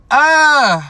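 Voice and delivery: high, loud drawn-out vowel; loud exclaimed "ah". Retold or imitated. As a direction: let out a showy high cry. Reacts smugly to flood of apologies.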